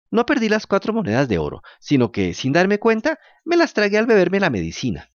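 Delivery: high, eager wooden puppet voice; lightly voiced puppet boy. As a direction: play the fibbing puppet, a bit nervous. Pinocho lies to the fairy.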